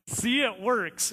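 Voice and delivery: see, it works, said slowly and drawn out; slow pointed remark. Retold or imitated. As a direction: spell it out slowly to her. Narrator tells mom at the pool.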